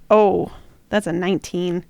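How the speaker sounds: usual speaking voice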